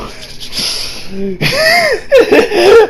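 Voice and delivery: high rising giggle. Real, not acted. Reviewer loving the moment, high giggle. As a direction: giggle with delight.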